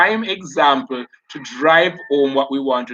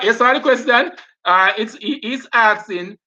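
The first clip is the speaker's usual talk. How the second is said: ordinary delivery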